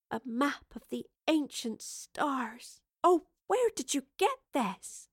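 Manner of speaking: slow breath of wonder rising to a high question; awestruck. Acted. Evie marvelling at ancient stars, awestruck. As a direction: linger over it in wonder, climbing high.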